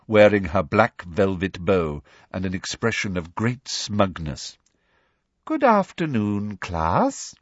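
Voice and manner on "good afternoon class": sugary-smug classroom greeting; smug teacher. A narrator's reading. Umbridge greeting her class, smug.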